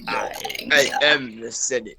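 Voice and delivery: very slow, drawn-out villain proclamation; slow mock-grand emperor; Palpatine. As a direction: play a Sith emperor, stretching each word. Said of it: "I'm the cool Uncle Palpy".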